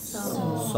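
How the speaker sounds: "স" with a long, held aw, like "saw"; long aw sound. Shown as a demonstration. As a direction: stretch the vowel in "স". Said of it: "long অ sound".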